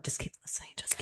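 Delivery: very soft, voiceless whisper; whispered self-talk. Retold or imitated. Speaker retells urging herself to listen, whispered self-talk. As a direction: coach yourself under your breath.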